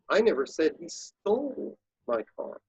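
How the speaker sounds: "stole" stretched with a pitch swell; stress on STOLE. Shown as a demonstration. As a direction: lean on "stole", let "my car" fall away. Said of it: "say that sentence quickly seven different ways".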